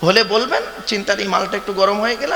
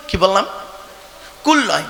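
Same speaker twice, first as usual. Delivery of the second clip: ordinary talk, nothing marked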